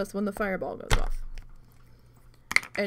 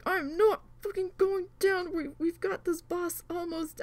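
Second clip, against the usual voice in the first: determined cry; 7 semitones higher than usual. Retold or imitated. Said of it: "and I'm like".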